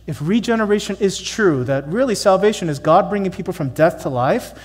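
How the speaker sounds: ordinary talk, nothing marked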